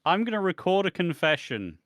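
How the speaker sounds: loud, high, declarative parody; mock announcement. Mocking someone secretly recording a confession, loud and high. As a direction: announce it far too openly.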